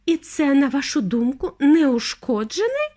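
indignant, stretching the last word. A narrator's reading. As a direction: question it in mild outrage. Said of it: "обурилася пані Тіві".

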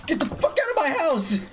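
fast, high-pitched outraged yell; rapid, high burst of anger; angry yell. Real, not acted. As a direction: chase intruders out, high and quick. Yelling at skeletons invading house.

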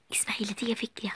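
soft plotting whisper; conspiratorial whisper. Acted. Sister telling her sister she has a plan, whispering. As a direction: lean in and whisper the secret.

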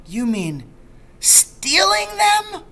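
shocked high-pitched question, drawn out; aghast. A narrator's reading. Boy asks his father in disbelief, aghast. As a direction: gasp it out in disbelief.

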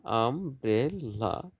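"Umbrella" slowly as আম, ব্রে, লা; three drawn-out beats in "umbrella"; split into syllables. Shown as a demonstration. Teacher dividing syllables for students, "umbrella" slowly split in three. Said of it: "syllable বিভাজন".

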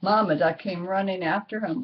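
ordinary delivery

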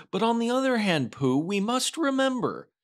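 high, small Piglet voice pitched well up; Piglet's voice. Acted. Piglet earnestly reminding Pooh on the walk, small high voice. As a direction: squeak it out like a tiny anxious pig.

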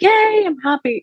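high, sing-song mock cheer; hammed-up giddy delight; mock happy. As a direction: overplay the glee, way up high. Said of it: "or, like".

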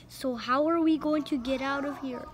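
ordinary delivery, nothing marked

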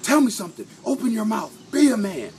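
acted sergeant major's loud, high tirade; yelling. Sergeant major to a Marine in trouble, yelling. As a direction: chew out a Marine at full volume. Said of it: "the sergeant major just starts yelling at him".

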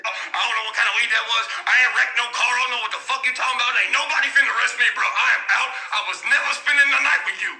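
fast, flustered backing-out ramble; rushed confused rant. Acted. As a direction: babble your way out the door. Confused friend leaves fast.

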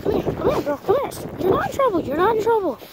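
high-pitched, reassuring pet talk; coaxing a dog. Real, not acted. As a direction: sweet-talk a nervous dog over. Coaxing a wary dog over.

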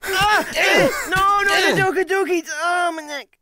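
high panicked yell; panicked shout. Pretend. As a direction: cry out in mock terror. Panicked servant.

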